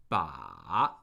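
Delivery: Ba dipping very low, then rising; third tone on Ba. Shown as a demonstration. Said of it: "that's the third tone".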